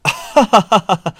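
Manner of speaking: high, loud gleeful cackle of victory; ringing laugh of a man who thinks he won; triumphant laugh. Acted. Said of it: "起来喊道".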